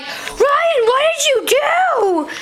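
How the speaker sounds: very high mock dad voice; high mock-angry dad impression; imitating dad. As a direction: ham up dad's blame, high and squeaky. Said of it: "he's like".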